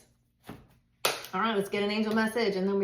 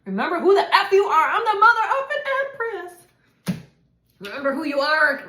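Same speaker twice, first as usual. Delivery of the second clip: much louder and higher, laughing through it; laughing shout; 10 semitones higher, 9 dB louder than usual. Real, not acted. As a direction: shout it out in joyous triumph. Ecstatic affirmation to the viewer.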